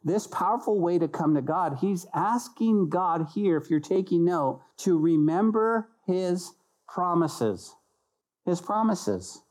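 normal talking voice

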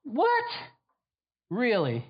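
sharp high incredulous What?. Real, not acted. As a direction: jump way up in disbelief. Preacher reacts in disbelief.

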